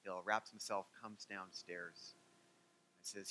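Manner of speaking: normal talking voice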